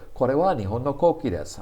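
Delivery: rattled off fast. Shown as a demonstration. Teacher showing how fast natives say it, rushed. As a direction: race through the sentence. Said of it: "I say it so fast".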